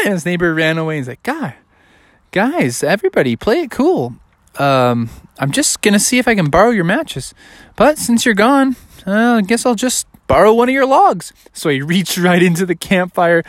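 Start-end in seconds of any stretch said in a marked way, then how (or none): 1.2-11.3 higher, lightly voiced innocent bear; mildly acted casual reassurance; placating bear. Bear placating fleeing neighbor.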